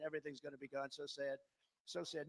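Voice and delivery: soft, low lament; mock mournful. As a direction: mourn it quietly.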